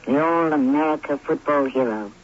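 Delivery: low, even storytelling voice; story narration. A narrator's reading. Host introducing the couple's backstory, calm storytelling. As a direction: set up the romance gently.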